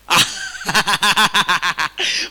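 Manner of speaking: big open laugh from the pulpit; hearty laugh. Real, not acted. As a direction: laugh out loud, amused. Preacher laughs at the crowd.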